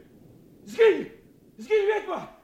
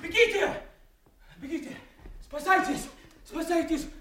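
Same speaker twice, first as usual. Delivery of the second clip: high frantic cries; panicked shrieks; 13 semitones higher than usual. Acted. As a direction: shriek as if chased. Edgar as mad Tom fleeing demons.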